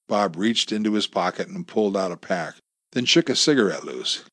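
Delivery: ordinary delivery, nothing marked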